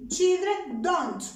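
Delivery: slow, deliberate model phrase; slow dictation. Shown as a demonstration. Teacher showing the negative form to students, slowly. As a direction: lay it out slowly for the class. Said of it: "negamos el auxiliar".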